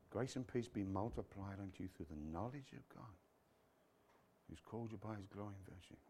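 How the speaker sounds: low mumble under the breath; hushed, barely voiced recitation; muttering meditation. Shown as a demonstration. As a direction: murmur scripture very softly to yourself. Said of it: "it means to mumble and to mutter".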